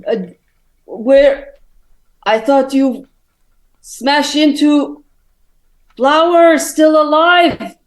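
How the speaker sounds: high, loud voice in halting bursts. Acted. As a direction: bellow it slowly, piece by piece. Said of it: "[name], he's like".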